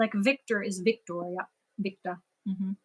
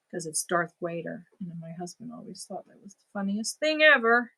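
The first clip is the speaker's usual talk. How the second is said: normal talking voice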